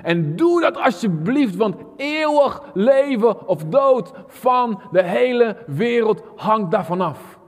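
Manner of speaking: Paul passionately urging the church, high and slow; pleading call. Acted. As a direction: beg the listeners to act, slowly and loudly. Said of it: "riep God door Paulus heen".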